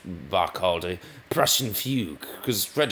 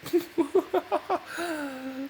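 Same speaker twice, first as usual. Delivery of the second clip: high giggle. Acted. Laughing inside the king voice.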